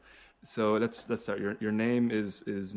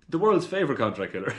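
laughing; 5 semitones higher, 8 dB louder than usual. Real, not acted. Joking intro of guest as hitman.

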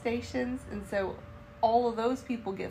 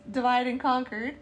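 ordinary delivery

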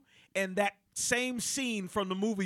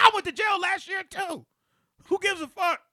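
higher, louder defiance; defiant rant; 11 semitones higher, 4 dB louder than usual. Retold or imitated. Rapper waving off the host's point, defiant. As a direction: dismiss it hotly. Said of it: "He said".

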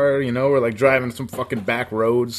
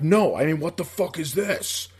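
dead serious; 2 semitones higher, 3 dB quieter than usual. Retold or imitated. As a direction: drop the joke and mean it. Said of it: "got really fucking serious".

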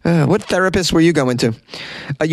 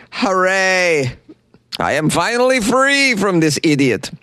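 put-on high, slow Ukrainian accent for the freed wife; hammed-up Ukrainian accent, gleeful; 6 semitones higher, slower than usual. Freed wife cheering on TV, in a Ukrainian accent. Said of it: "I get to do a Ukrainian accent".

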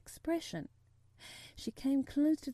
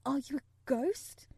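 mildly frightened, slow and higher, rising question; a touch of fear, asked slowly; half-frightened whisper; 4 semitones higher than usual. A narrator's reading. Sick boy to a stranger at night, half-frightened. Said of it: "in a half-frightened whisper".

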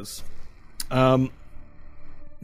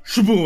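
loud, high imitated crash; crash sound effect; 8 semitones higher, 8 dB louder than usual. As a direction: burst it out like a table collapsing. Table breaks under character.

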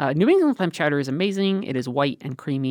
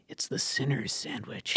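mock solemn confession; 7 dB quieter than usual. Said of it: "York is like".

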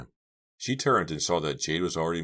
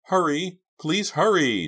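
high, loud, desperate plea; begging; 14 semitones higher, 8 dB louder, slower than usual. A narrator's reading. Trapped girl begging friends to hurry, desperate. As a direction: plead urgently at a raised pitch. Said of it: "Jade begged".

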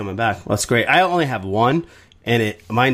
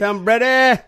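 mock Boston accent; 12 semitones higher, slower than usual. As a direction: overdo the Boston twang on "Tom Brady", pitched way up. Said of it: "I don't even know what I was doing".

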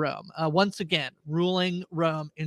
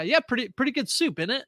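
mock London accent; 7 semitones higher than usual. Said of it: "I just love how often he'll say".

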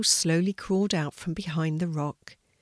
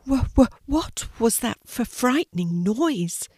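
higher, stuttering on first sounds; frightened stammer; 5 semitones higher, 3 dB louder than usual. A narrator's reading. Sea turtle startled by a frightening noise, stammering. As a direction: trip over the words in fright. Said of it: "he stammered".

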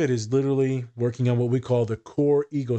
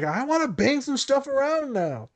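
much higher, hammed-up Nick, eager to smash; mock Nick, voice jumping up, itching to bang things; imitating Nick; 13 semitones higher than usual. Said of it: "He's like".

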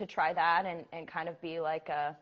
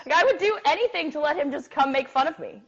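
giggling through a gushy confession; laughing; 6 semitones higher than usual. Real, not acted. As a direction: laugh while you gush. Gushing about crush, laughing.

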